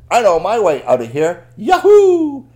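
loud, high jubilant shout; storybook heroine exulting at full voice; triumphant cheer. Acted. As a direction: announce the escape, then whoop. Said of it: "And she said".

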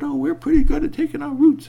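high little mouse voice, loud; squeaky mouse voice. Acted. Mice offering to dig, high squeaky voice. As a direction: pipe it up like a tiny mouse.

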